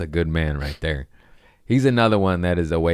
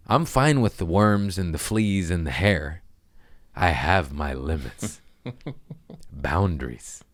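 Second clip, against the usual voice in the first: usual speaking voice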